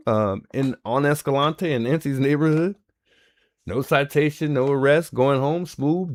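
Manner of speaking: normal talking voice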